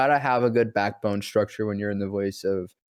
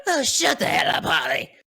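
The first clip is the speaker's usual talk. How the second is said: annoyed shout; 5 semitones higher than usual. Real, not acted. Yelling at interrupting Holly.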